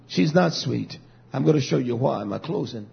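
ordinary talk

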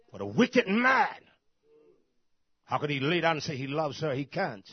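loud, rising condemnation; fiery preaching. Real, not acted. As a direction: build up and thunder at the wicked man. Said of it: "I talk like that now".

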